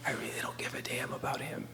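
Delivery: low, whispered indifference; cold whisper. Acted. Voices officer staring into camera.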